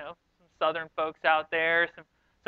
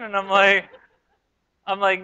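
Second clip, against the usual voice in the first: normal talking voice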